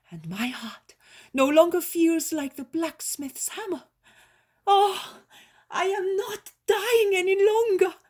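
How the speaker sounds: bubbling over with relief; joyful old woman. A narrator's reading. Healed old woman joyfully telling her daughters-in-law she's well.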